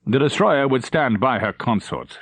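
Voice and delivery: ordinary delivery, nothing marked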